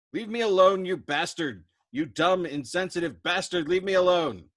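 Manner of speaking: high, loud enraged cry near tears; wounded man screaming insults at his crewmate; furious sobbing outburst. Acted. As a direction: rage at him through sobs. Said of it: "Yossarian sobbed".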